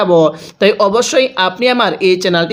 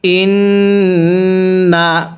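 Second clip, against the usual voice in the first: tajweed recitation; much slower than usual. Shown as a demonstration. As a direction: lead the recitation for them to repeat. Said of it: "হ্যাঁ, আমার সাথে পড়ুন।". (সাথে পড়ার জন্য উচ্চস্বরে তিলাওয়াত)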